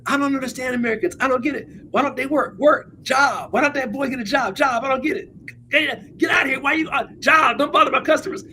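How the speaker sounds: put-on high, exasperated broken-English Burmese accent. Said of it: "in this broken accent".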